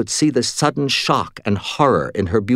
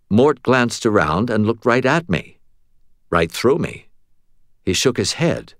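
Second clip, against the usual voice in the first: usual speaking voice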